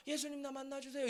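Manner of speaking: soft, small mock plea that gets lost in the crowd; timid plea. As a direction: say it meekly, too quiet to be heard. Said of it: "이 바디메오의 목소리는 묻힙니다". (작고 소심한 흉내 간청)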